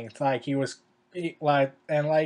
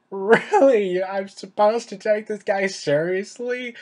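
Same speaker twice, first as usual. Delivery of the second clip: incredulous; 5 semitones higher, 6 dB louder, slower than usual. Retold or imitated. Acts out incredulous viewer reaction.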